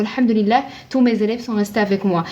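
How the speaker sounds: normal talking voice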